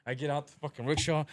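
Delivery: ordinary delivery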